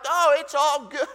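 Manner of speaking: high, loud laugh through the words; laughing while pretending it's fine. Real, not acted. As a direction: brush it off with a laugh. Laughing off the watch.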